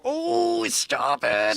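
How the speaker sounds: an odd, put-on voice with a high "Oh"; mock voice. A mock voice for Trump waving off the couple. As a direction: camp it up. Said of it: "he's gonna go".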